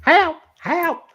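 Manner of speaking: mock distress squeal, very high; screechy cry. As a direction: cry for help way up in pitch. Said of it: "Jesus, Screecher".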